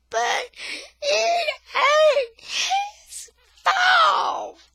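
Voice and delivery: very high, slow, tearful shout; yelling. Acted. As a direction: scream it like across the house. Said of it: "yells like she's in a whole different room".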